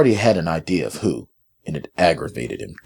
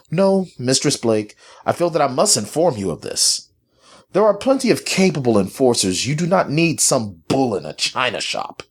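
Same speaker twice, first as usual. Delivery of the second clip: high, coldly emphatic objection; cold derision; 8 semitones higher than usual. A narrator's reading. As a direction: object with cold, lofty disdain. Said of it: "Mandrake chuckled coldly".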